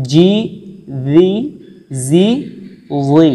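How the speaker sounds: জি heavy and রি light, alternating; minimal-pair drill; contrasted letters. Shown as a demonstration. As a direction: make জি and রি sound clearly different. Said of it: "চারটার পার্থক্য ... শুনলে বুঝতে পারবেন".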